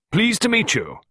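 high, loud, hearty hello; robust greeting. A narrator's reading. Miss Peake meeting Costello in the garden room, robust. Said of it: "she replied robustly".

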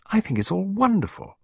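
high bright exclamation of delight; exclaimed. A narrator's reading. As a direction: gush it up high with joy. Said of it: "she exclaimed".

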